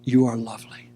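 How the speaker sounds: low, deep voice of the King; the King's voice. Acted. King answers the dark maiden, low and deep. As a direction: drop low, speak as the King. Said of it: "and he says".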